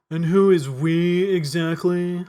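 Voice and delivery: slow, in-character detective voice; lightly voiced detective; gruff detective. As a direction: demand it slowly like a suspicious cop. Flint character voice.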